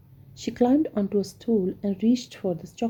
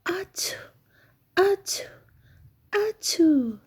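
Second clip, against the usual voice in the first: big sneezes; 11 semitones higher, slower than usual. A narrator's reading. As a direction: sneeze it out hard, one after another. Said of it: "he couldn't stop sneezing".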